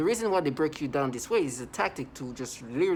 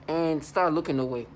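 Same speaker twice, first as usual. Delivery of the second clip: ordinary delivery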